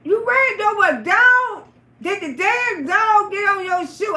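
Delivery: high loud disgusted yell; retold shout. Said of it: "He said".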